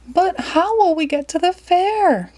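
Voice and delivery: granny asking the driver, worried; Granny asks. Acted. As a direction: fret it out as an old lady. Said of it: "Granny asked".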